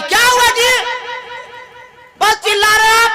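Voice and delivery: annoyed wife. Acted. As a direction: snap back from inside, put out. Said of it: "अंदर से आवाज देगी".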